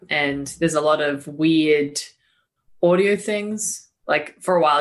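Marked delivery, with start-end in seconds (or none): none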